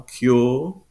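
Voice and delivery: "cure" as KYAW, no R; British "cure", one long vowel; extended vowel. Shown as a demonstration. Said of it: "extended vowel".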